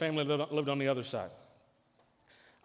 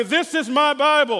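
triumphant; 9 semitones higher, 9 dB louder than usual. Acted. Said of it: "Let's say it one more time".

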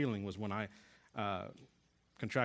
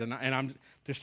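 normal talking voice

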